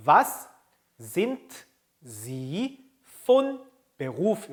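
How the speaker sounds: slow, word by word with gaps; read-along pacing. Shown as a demonstration. Teacher leads learners reading along, word by word. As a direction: leave space after each word for learners to echo. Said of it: "따라 읽어봅시다".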